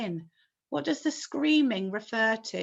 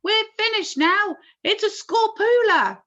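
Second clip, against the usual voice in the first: high loud panicked cry; 12 semitones higher, 8 dB louder than usual. A narrator's reading. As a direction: despair aloud. Fire chief panics.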